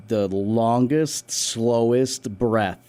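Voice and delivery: longest, slowest drawn out very slowly; slowly, acting out the long breath; slow stretched words. Real, not acted. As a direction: stretch each word out. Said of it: "describes slow breath".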